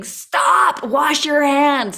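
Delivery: high, loud frantic cry to stop; mock panicked scream at the kids; screaming notes. Notes around the house screaming at the kids, frantic loud cry. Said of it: "notes around the house screaming".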